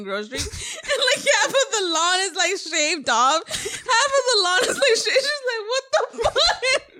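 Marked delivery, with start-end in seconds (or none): 3.5-6.9 high, laughing near tears; laughing hard. Real, not acted. Telling sibling about Mom and shaved lawn, laughing hard. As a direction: crack up mid-sentence.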